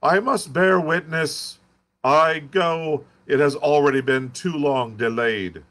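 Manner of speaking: high, loud ranting shout; shouted at the top of his voice. Acted. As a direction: curate bellowing his refusal. Curate leaving to witness.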